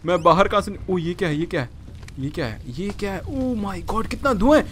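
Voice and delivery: ordinary talk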